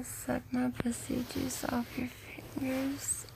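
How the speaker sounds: slow, drawn-out whisper. Real, not acted. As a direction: breathe the words out slowly. Intimate call, slow whisper.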